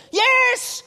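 high, loud emphatic yes; mock kid insistence; excited son. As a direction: imitate a thrilled boy confirming it. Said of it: "And I go".

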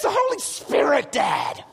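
put-on high kid voice, dropping on Dad; exasperated son. Son answers Dad's question, high insistent kid imitation. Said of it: "He goes".

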